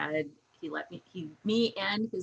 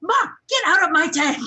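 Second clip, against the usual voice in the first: high, loud furious shout; angry teen yelling from a tent; mad yell; 10 semitones higher, 10 dB louder than usual. Retold or imitated. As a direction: scream at mom to leave. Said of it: "she was so mad".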